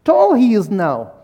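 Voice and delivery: impressed. Retold or imitated. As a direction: imitate a friend marvelling at a grown boy. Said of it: "Abdueli saw my son and said".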